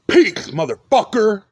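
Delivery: a defiant shouted goodbye; shouted sign-off. Acted. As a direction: spit the farewell out loud and high. Taunting exit after threats.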